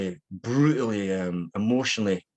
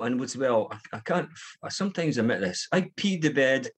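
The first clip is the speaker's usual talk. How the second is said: ordinary talk, nothing marked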